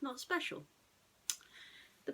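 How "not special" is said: ordinary delivery, nothing marked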